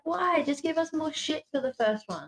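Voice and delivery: high, whiny exasperation; exasperated complaint. Retold or imitated. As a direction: complain like a let-down fan. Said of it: "I was like".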